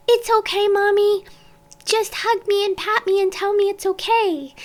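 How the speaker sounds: put-on high, small child's voice, gently coaxing; little girl voice. Four-year-old comforting her mom, in a high little-girl voice. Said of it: "I'd say".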